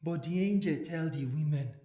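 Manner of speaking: usual speaking voice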